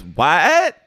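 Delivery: loud, high incredulous "what"; mock disbelief. Acting out Sheena answering Katie's attack, mock disbelief. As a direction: imitate an affronted, rising comeback. Acts out Sheena's stunned retort.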